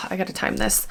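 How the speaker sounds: sigh, then whisper under her breath; whispered through a contraction. Real, not acted. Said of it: "I'm having a Braxton Hicks contraction right now".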